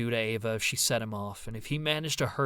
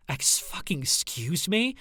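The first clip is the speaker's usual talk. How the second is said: slow, higher growl of outrage; 6 semitones higher, slower than usual. Acted. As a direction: spit out the disbelief. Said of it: "Ava growled".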